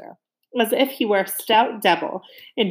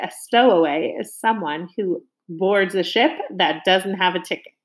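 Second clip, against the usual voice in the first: plain reading voice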